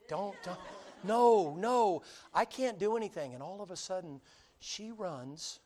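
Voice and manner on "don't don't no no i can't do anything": higher, loud helpless no; helpless protest. Retold or imitated. Officer helplessly protesting as she runs, loud. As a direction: cry out, powerless to stop her.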